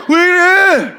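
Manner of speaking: high, acted jolt of alarm; mock startled. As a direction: jump in pitch as if just startled. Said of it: "막 깜짝 놀라가지고". (깜짝 놀란 척)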